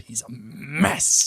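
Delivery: slow, drawn-out sitcom character impression; lightly put-on voice, stretched out slowly; Selena Meyer. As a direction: lean hard and long on MESS. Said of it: "doing more Selena Meyer from Veep".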